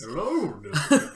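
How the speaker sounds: half-hearted Sean Connery voice; Sean Connery accent. Pretend. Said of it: "I can't even do a Sean Connery accent".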